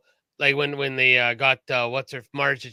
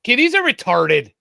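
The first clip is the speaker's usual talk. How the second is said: loud blurt; 8 semitones higher, 7 dB louder than usual. Real, not acted. Blurts out loudly.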